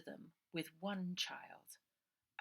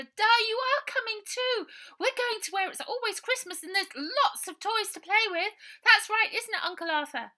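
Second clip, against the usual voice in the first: put-on high, loud happy child voice; a small girl bubbling about Christmas; excited little girl; 16 dB louder than usual. A narrator's reading. Bonnie telling Ida about the toys, high excited child.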